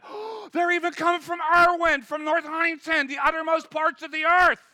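loud, high, amazed shouting; excited shout. Acted. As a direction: shout out every town. Disciple shouting at the crowds.

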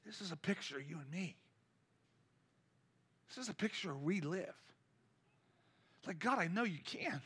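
soft, intimate drop after a shout; hushed. Real, not acted. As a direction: let it sink in quietly. Sudden hush after the acted shriek.

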